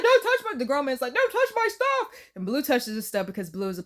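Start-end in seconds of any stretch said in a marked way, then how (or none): 0.0-0.6 high, loud protest; exaggerated indignant telling-off; mock scolding. Grown man scolds Blue in retelling. 1.1-2.1 high-pitched possessive protest; exaggerated indignant telling-off; mock scolding. Grown man scolds Blue in retelling.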